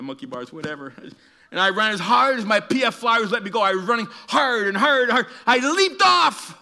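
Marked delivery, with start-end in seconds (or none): 1.5-6.6 loud, high, building to a long shout; building excitement. Retold or imitated. Preacher reliving his second running leap, rising excitement. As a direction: drive it up to a triumphant yell.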